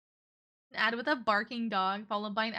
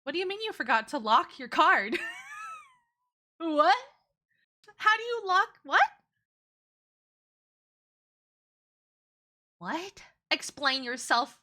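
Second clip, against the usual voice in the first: higher, louder shocked disbelief; incredulous; 6 semitones higher, 3 dB louder than usual. Real, not acted. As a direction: react in stunned disbelief. Shocked at forgot to lock card.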